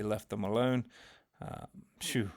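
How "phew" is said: usual speaking voice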